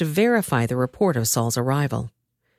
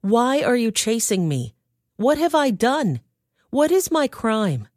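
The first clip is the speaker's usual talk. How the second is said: higher-pitched, insistent questions; pleading protest; 6 semitones higher than usual. A narrator's reading. David challenges the king who hunts him, pleading protest. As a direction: protest your innocence, pitch lifted.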